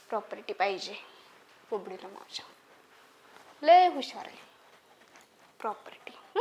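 very soft, low mutter; hushed, low self-talk; murmuring. Acted. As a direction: murmur it to yourself, trailing away. Murmuring to herself over the child.

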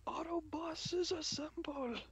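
Transformer. Pretend. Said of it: "a Transformer".

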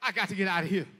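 usual speaking voice

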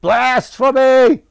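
high, full-volume drawn-out shout; furious ranting. A narrator's reading. Merchant storms out of the square ranting, furious high shout. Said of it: "the merchant was ranting".

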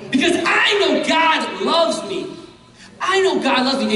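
high, ringing I know; God loves me, exultant; exultant proclamation. Real, not acted. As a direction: proclaim with joy. Preacher proclaims.

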